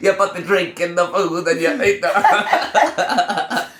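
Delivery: slow, high hammed-up Italian accent, laughing; Italian Bill Cosby. Cohosts riffing on an old bit, hammed-up Italian accent. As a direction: ham up an Italian accent slowly. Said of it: "the Italian Bill Cosby".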